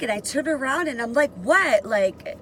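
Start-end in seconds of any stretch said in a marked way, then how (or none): 1.3-1.8 higher, louder defiant retort; with attitude. Retold or imitated. Inmate answers a yelling guard, retold with attitude. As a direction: throw it back with attitude. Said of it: "I had an attitude".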